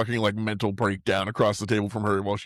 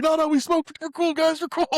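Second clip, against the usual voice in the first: much higher squeaky hammed-up placating; mock placating. Character begging the guys he's cool, high mock-placating squeak. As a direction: talk them down, squeaking. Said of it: "it's like".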